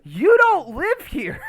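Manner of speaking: a slow, hammed-up squeal of fake indignation; mock outrage. Real, not acted. Teasing his co-host about a cameo, in high, loud mock outrage.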